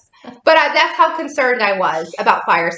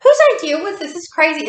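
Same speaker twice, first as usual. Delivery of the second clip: higher, exasperated complaining to her friend; mock arguing; 6 semitones higher than usual. Arguing with Leslie at the fire walk, exasperated. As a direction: act out the bickering, fed up. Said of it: "we're arguing with each other".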